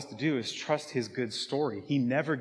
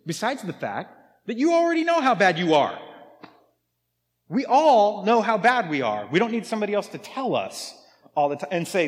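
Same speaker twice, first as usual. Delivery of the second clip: high-pitched, incredulous preaching; worked-up, indignant appeal; exasperated protest; 5 semitones higher, 4 dB louder than usual. Real, not acted. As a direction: protest it, rising higher and louder toward "how bad". Preacher protesting against guilt-based evangelism.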